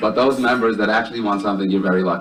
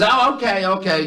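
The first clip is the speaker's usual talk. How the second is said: high-pitched falsetto wife; cartoonish high wifely voice; wife's reply; 9 semitones higher than usual. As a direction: put on a high woman's voice, dropping off at the end. Yelling wife answers husband.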